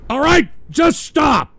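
high loud pleading shout; angry yell. Real, not acted. Host shouting at the crew over the clips, angry yell. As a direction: bark at them to quit. Yelling at soundboard again.

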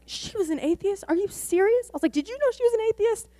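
very high, rushed astonishment; disbelieving. Retold or imitated. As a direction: ask in total shock. Disbelief at atheist news.

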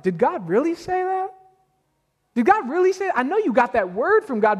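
high, needling question from the serpent; serpent's doubting voice. Retold or imitated. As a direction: plant doubt like the serpent in the garden. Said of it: "similar to what the serpent did".

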